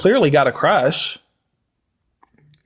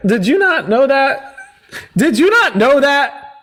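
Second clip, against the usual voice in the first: mock disbelief; 6 semitones higher, slower than usual. Mocking disbelief at opponent.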